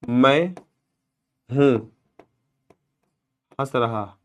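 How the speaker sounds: slow, quiet, one word at a time; quiet, slow word-for-word breakdown. Shown as a demonstration. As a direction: spell out the Hindi pieces slowly and softly, word by word. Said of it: "क्या हो जाएगा".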